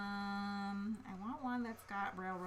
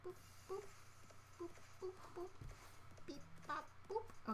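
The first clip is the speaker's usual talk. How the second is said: soft high boop beeps while drawing; drawing noises; 9 semitones higher, 10 dB quieter than usual. Acted. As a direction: hum little beeps as you draw. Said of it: "those were my drawing noises that time".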